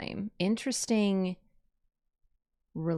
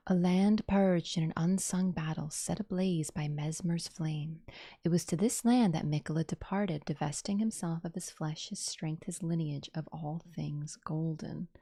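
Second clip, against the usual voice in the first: trailer narration; 3 semitones lower, 4 dB quieter than usual. Acted. Gamer reading the video description aloud, solemn narration. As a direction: read it like an epic trailer.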